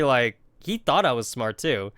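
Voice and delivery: normal talking voice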